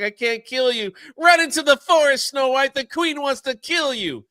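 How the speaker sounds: hammed-up urgent cry to flee; urgent warning.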